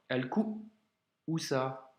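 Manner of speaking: slow "al-ku'usa", long stop after "ku"; two-part demo of "al-ku'usa"; hamza blocked. Shown as a demonstration. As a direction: cut "ku" short, wait, then "usa". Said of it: "Blocage encore".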